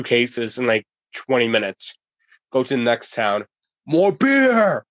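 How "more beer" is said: high, drawn-out holler for beer; mock scream. Giant demanding drink in the next town, mock scream. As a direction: bellow the demand, imitating a thirsty giant. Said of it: "He screams".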